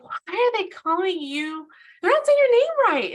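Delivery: high, loud, incredulous questioning; indignant retold outburst. Sister challenging her brother over his mispronounced name, indignant.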